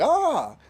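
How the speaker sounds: high-pitched imitation battle yell; hammed-up high cry, not full volume; drawn-out mock anime scream. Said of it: "Indra's yelling at the bitch".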